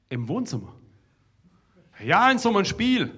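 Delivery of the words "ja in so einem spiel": loud, high call back across the room; shouted reply. Retold or imitated. Player shouting back to the curious guest, loud and high. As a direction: yell the explanation over the wall.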